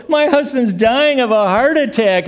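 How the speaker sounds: high, loud frantic plea; a hammed-up wife pleading at the desk; mock panic. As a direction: play the panicked wife rushing in. Mimicking frantic patient's wife.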